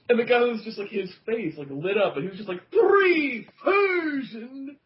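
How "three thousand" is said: high, slow amazed gasp of a question; drawn-out astonished echo; genuine surprise. Retold or imitated. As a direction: stretch it out, truly stunned. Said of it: "genuine, like, surprise".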